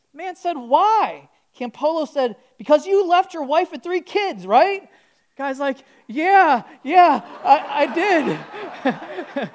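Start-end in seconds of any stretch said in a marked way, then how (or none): 0.5-4.9 higher, louder pointed question; louder exchange. Retold or imitated. Tense exchange in the car. 6.1-9.6 loud, higher laughing yeah; laughing admission. Retold or imitated. Stunned man admits, laughing.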